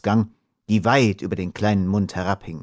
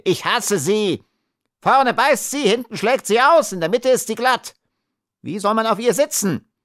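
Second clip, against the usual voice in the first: high-pitched, exasperated ranting; little man ranting at the top of his range; outraged cry; 14 semitones higher than usual. A narrator's reading. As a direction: shriek your grievance, high and indignant. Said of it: "schrie der Kleine".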